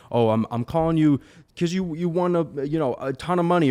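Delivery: mock the pushy cold caller who lies; scammy sales pitch. Shown as a demonstration. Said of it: "if you sound like that".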